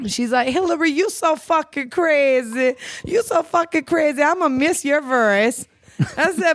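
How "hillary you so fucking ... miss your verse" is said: higher-pitched New Orleans lady imitation; New Orleans accent. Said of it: "with that damn accent".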